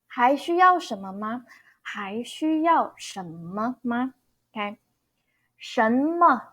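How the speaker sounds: normal talking voice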